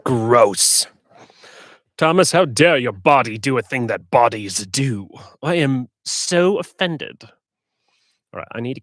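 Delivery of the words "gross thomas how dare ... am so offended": loud, hammed-up offence; playfully appalled, loud and overdone; mock outrage. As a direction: scold yourself in theatrical indignation. Mock-scolds himself for burping.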